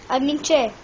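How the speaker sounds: normal talking voice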